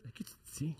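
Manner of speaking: breathy whispered aside. Real, not acted. A cheeky whispered question to the group. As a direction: lean in and whisper the tease.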